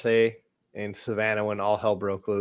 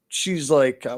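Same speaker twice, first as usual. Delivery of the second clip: ordinary talk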